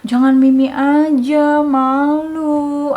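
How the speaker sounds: coaxing mommy voice. Retold or imitated. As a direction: gently shame a toddler in a lilting voice. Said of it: "Aku bilang gitu". (suara ibu membujuk, dipanjangkan)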